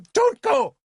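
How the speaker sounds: very high, loud scream. A narrator's reading. Princess screams at the ox drivers, frantic. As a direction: shriek to stop them. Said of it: "Argant screamed at the ox drivers".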